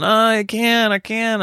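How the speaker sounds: high-pitched imitation of complaining children; whining kids. Kids struggling to keep up with dad, mock whining. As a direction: whine it like a worn-out kid.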